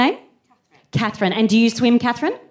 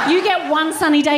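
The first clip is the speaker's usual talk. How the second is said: usual speaking voice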